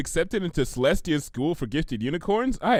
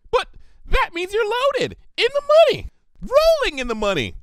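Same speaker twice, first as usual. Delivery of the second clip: high, bubbly, rapid pony chatter; giddy party pony rambling about money; hyper pony; 6 semitones higher than usual. Acted. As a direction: gush it all out like an excitable filly. Narrator voices Pinkie rambling.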